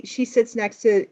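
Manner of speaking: normal talking voice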